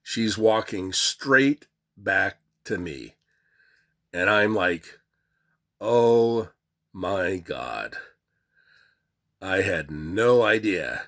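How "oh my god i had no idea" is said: mock dread. Dread as attendant approaches him.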